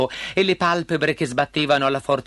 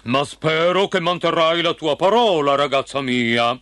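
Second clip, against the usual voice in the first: thundering king; 4 dB louder than usual. A narrator's reading. King holding his daughter to her word, thundering sternly. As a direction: speak as a commanding royal father. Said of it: "tuonò il re".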